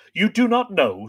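loud, high incredulous challenge; angry demand. Acted. Fionn confronts his terrified servant, angry disbelief. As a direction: throw the question back in disbelief.

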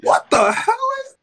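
high-pitched startled yelp; shocked shriek. Real, not acted. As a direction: squeal it out in disbelief. Stranger suddenly appears in game.

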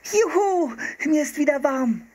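put-on very high, jubilant girl's cheer; happy cry. A narrator's reading. Girl finally warm in her jacket, happy high cry. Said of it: "rief Anne glücklich".

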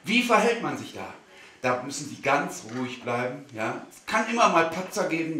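ordinary talk

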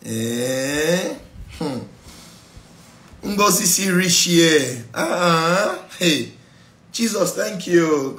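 mock wonder. Acted God marvelling at people.

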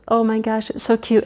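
ordinary delivery

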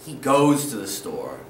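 "He goes to the store" low and settled, firm fall on goes; correct model. Shown as a demonstration. Teacher modelling the correct sentence for students, low calm voice. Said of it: "You wanna sound correct".

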